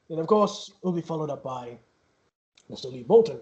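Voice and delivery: usual speaking voice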